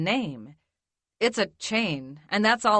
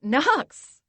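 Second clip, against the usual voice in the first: higher, louder startled call of his name; shocked cry; 6 semitones higher, 3 dB louder than usual. Acted. Woman shocked to see her boyfriend burst in, cries out.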